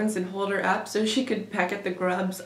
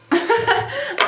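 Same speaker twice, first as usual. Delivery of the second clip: high burst of laughter; 5 dB louder than usual. Real, not acted. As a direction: laugh out loud, pitched high. Laughs at high school memories.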